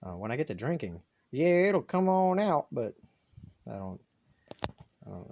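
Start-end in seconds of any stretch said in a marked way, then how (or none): 1.3-2.7 slow, higher Southern drawl; Southern accent. Shown as a demonstration. Vlogger showing the accent drink brings out, Southern drawl. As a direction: let the Southern accent stretch the words out. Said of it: "I hate my own Southern accent".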